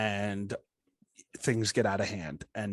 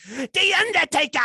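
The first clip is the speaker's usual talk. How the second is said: hammed-up ring announcer, much higher and louder; ring announcer call; 8 dB louder than usual. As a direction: boom it out like an arena announcer. Wrestler name called out announcer-style.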